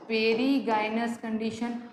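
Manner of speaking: "perigynous" said slowly and carefully; slow dictation. Shown as a demonstration. Teacher repeating the second condition, slow dictation.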